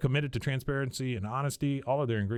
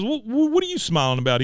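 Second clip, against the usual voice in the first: high, loud, indignant mock question; host voicing Peggy accusing her husband, indignant; imitating a wife; 10 semitones higher, 7 dB louder than usual. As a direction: squeak out the accusation like a hurt wife. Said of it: "She goes".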